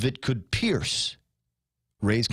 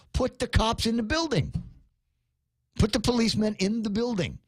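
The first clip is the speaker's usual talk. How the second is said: raised, heated rant; 6 semitones higher than usual. Real, not acted. Host ranting on air about school police, heated. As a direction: keep pushing the point higher and faster.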